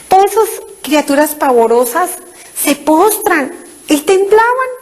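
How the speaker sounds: normal talking voice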